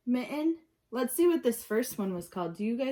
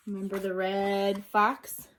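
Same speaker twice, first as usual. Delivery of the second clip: plain reading voice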